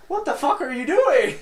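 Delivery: high, loud startled friend barging in; Zach impression. Friend walking in on drunk buddy, loud high startled Zach. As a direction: do Zach catching him in the shower. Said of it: "That's a great Zach impression".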